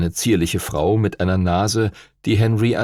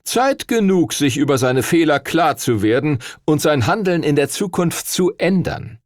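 lecturing official; 7 semitones higher than usual. Acted. Stuart Todd cites the statutes to Duncan, stern official tone. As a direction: lay down the law with cool authority.

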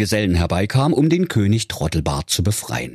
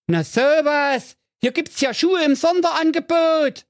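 calling stranger. Acted. Disguised man luring Jacqueline with shoes, high teasing call. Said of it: "der da rief".

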